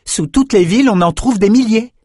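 Ambre's voice. A narrator's reading. Ambre telling friends about rats, high eager voice. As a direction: explain it with growing excitement. Ambre explains her idea.

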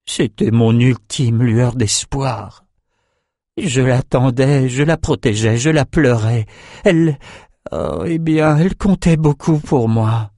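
slowly voiced sorrow, lightly acted; grieving. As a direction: mourn a lost loved one. Grieving character speaks of loss.

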